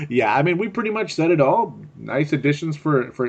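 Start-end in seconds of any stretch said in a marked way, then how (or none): none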